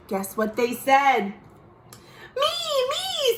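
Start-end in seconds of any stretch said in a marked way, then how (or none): 2.4-3.4 very high, eager "me, me"; eager kid. Acted. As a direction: beg to join like a little kid. Brothers eager to play.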